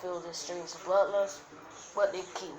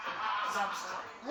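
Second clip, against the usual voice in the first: plain reading voice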